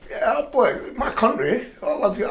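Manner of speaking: slow, high, mocking patriotic gush. Mocking flag-wavers, a slow laughing gush. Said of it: "I'm not one of these".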